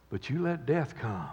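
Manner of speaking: ordinary talk, nothing marked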